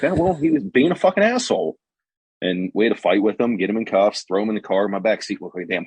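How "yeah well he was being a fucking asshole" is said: pitched up, mock-indignant snap; half-joking defiance, raised pitch; defiant retort. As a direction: toss it off like it was obvious. Cop justifies the takedown.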